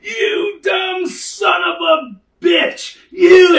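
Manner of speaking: high, very slow, loud bellowed insult; furious shout. Retold or imitated. Driver squares up to the kid who hit him, slow furious shout. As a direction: drag every word out at a furious roar. Angry driver rolling up sleeves.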